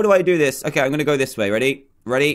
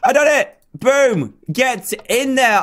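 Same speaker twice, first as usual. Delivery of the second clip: excited cheer; 8 semitones higher, 3 dB louder, slower than usual. Real, not acted. Triumphant cheer in game.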